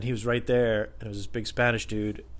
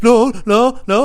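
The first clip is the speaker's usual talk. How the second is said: urgent protest; 12 semitones higher, 11 dB louder, slower than usual. Retold or imitated. Retold manager intervening.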